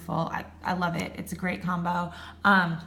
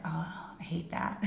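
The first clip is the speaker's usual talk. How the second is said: whispered disgust; 3 semitones lower, 9 dB quieter than usual. Retold or imitated. Self-quote of dislike.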